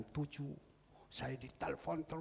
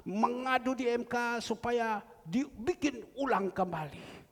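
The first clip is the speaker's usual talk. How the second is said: higher, louder preaching burst; 9 semitones higher, 9 dB louder than usual. Acted. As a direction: proclaim it to the whole church. Preacher repeats it at full force. (berkhotbah dengan suara keras)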